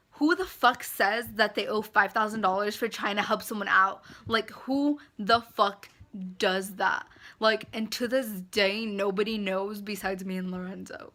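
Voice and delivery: ordinary delivery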